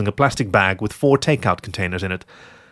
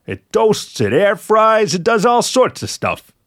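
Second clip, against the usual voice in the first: high-pitched, excited sales pitch; enthusiastic listing; 11 semitones higher, 4 dB louder, slower than usual. Acted. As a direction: rattle off features with rising excitement. Fox enthuses about toaster oven.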